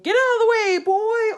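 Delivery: loud, high commanding yell; ordering shout. Acted. King ordering Boy out of the fight, loud shout. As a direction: bark the order over the battle. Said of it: "ordered the king".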